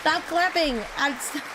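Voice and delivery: ordinary delivery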